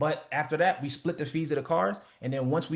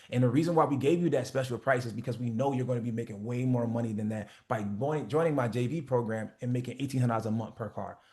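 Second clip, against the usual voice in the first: confident sales pitch; 4 semitones lower than usual. Shown as a demonstration. Coach demoing a rental car pitch, calm confident sales voice. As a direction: sell it calmly like you own the market. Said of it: "say the price the same way you describe the product".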